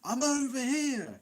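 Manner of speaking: high, slow mock call across a building; mock shout. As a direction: stretch it out like calling to a lost friend. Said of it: "we're not gonna start shouting, going".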